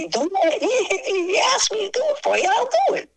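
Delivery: high-pitched, breaking into laughter; squealing with amusement; laughing retold reply. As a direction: crack up while quoting yourself. Cracking up at own retold reply.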